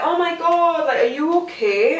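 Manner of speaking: high, startled worry; shocked concern. Retold or imitated. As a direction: gasp out concern. Neighbour shocked at the crash.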